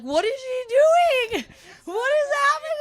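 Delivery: very high, squeaky panicked whisper-cry; panicked squeal. Retold or imitated. Frightened patient on the table, in a high panicked squeak.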